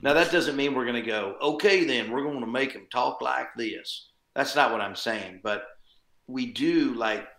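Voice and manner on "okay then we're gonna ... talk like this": mock a hammed-up country accent; countrified accent. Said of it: "it's more country, you know, more countrified".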